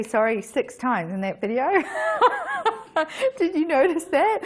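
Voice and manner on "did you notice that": asked through real laughter, high; giggling while teasing a friend; laughing. Real, not acted. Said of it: "Today, I'm laughing".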